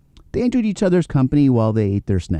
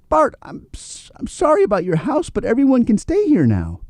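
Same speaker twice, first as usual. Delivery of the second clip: apologetic rabbit; 12 semitones higher than usual. Acted. Robbie apologises to beaver.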